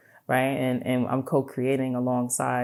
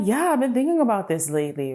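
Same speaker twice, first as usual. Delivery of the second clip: eager reply; 7 semitones higher, 3 dB louder than usual. Retold or imitated.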